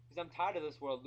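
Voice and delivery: ordinary delivery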